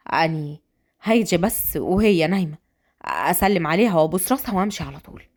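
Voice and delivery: polite male character; respectful suitor. Acted. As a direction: ask gently, voice dropped.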